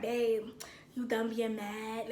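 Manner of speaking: low, soft, slow murmur; calm coaxing. Retold or imitated. Boyfriend lying beside crying girlfriend, calm and soft. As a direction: gently coax a sulking partner. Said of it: "He's the most calmest person".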